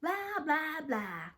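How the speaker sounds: high, jeering echo; mocking. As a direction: join the teasing, falling at the end. Friend joins in the mocking.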